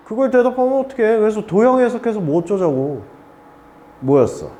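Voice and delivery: ordinary talk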